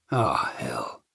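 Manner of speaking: low, soft swearing under his breath; muttered curse. A narrator's reading. Brandon seeing two rough men enter the lobby, a low quiet curse. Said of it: "Brandon swore".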